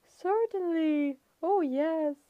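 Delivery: high, slow, sugary falsetto. A narrator's reading. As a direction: reassure sweetly in falsetto. Grover's falsetto.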